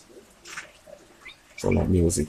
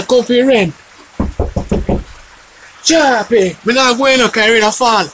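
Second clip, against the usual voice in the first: loud high call through the door; shouting for the tenant to answer; 9 dB louder than usual. Acted. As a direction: hail Sharp from outside, pitched high. Calling out at the door.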